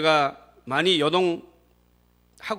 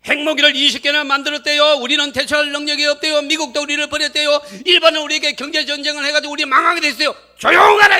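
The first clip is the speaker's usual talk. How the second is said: high, loud run of panicky reports, capped by a shout; alarmed shouting; 10 semitones higher, 12 dB louder than usual. Acted. Preacher voicing the nation's fears, then silencing them, loud shouting. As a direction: pile up the alarms at full voice.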